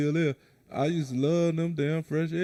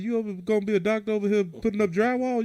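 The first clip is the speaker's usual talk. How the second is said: mock scolding; 6 semitones higher than usual. Teasing young guy retelling.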